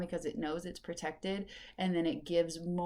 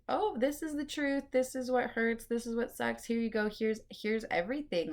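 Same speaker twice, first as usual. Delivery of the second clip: higher, lightly put-on voice of the moon spilling hard truths; voicing the blood moon; 4 semitones higher than usual. Podcaster voicing the blood moon to listeners, lightly put-on higher voice. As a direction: play the moon as it hands over the painful truth. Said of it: "They're like".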